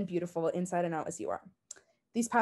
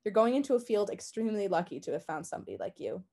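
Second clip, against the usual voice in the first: usual speaking voice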